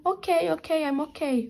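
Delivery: high, teary mock reply while crying; mock tearful. Answering the kind stranger in the metro, high and tearful. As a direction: imitate her tearful brave answer. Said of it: "E chorando, né?".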